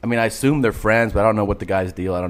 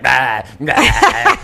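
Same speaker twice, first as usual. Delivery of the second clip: loud wordless mock drunk noises; incoherent drunk; 5 dB louder than usual. Comic imitates hammered friend at the table, incoherent. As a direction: imitate a drunk friend who can't form words. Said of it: "he's totally incoherent".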